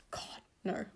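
soft joking whisper; whispered aside. Real, not acted. Teasing her sister in a quick whispered aside. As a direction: breathe it out as a throwaway tease.

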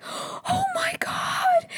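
very high, drawn-out squeak; mock overwhelmed gasp. Jocelyn hears she has a family, mock overwhelmed squeal. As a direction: stretch it out in hammed-up shock.

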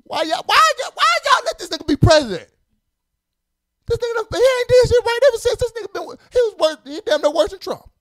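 high-pitched comic indignation; exasperated rant. Real, not acted. Host griping about the president, exasperated. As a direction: complain with the voice pushed way up high.

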